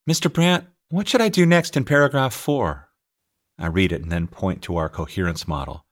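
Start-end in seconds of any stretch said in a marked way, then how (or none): 0.1-2.9 higher, lightly voiced student calling out; student asking. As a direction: call the teacher over, eager and high. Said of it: "Raylina wants to know".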